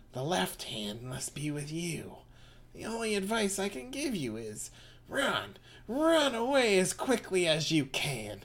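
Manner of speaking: put-on high, eerie old witch. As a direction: croon it like a doom-saying witch. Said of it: "do your witch voice".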